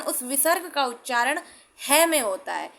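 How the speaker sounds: ordinary delivery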